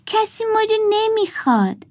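put-on high-pitched doll girl, matter-of-fact; little girl doll. A narrator's reading. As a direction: speak up high like a small girl.

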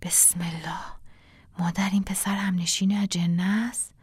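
urgent hushed whisper; whispered in her ear. Acted. Hileh whispers into the old woman's ear, frightened. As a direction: lean in and whisper the fearful question. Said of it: "توی گوشش گفت".

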